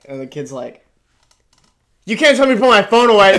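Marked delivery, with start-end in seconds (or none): 2.0-3.4 high, loud, fast bratty yell. Retold or imitated. Kid confronting a shopping cop, loud bratty yell. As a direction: shout it like a defiant teen.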